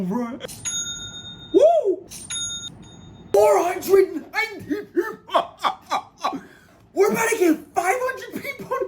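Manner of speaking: very high, shouting and laughing in disbelief; ecstatic shouting. Real, not acted. Streamer watching site traffic explode, ecstatic shouting. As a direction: yell out every new number. Nearing five hundred visitors.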